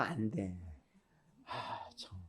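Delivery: unvoiced sigh of exasperation; a soft unvoiced aside; under breath. Real, not acted. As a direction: breathe it out almost silently. Aside sigh mid-sermon.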